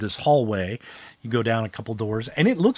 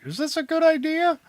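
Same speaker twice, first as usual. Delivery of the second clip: high, hesitant worried voice; nervous Steve. Acted. Game master voicing a worried companion, high and nervous. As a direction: voice him as a jittery sidekick, pitched up.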